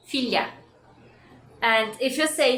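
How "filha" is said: Filha said as "fil-ya", the lh like a y; filha with lia. Shown as a demonstration. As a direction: Filha को "फ़िल्या" बोलो, lh को y जैसा. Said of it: "Just imagine the H is an I or a Y".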